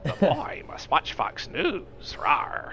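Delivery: slow, hoarse half-hearted Mar growl. Pretend. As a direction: growl it slowly with a raw throat. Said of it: "can't do a good Mar voice".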